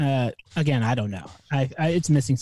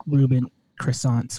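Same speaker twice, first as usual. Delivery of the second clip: usual speaking voice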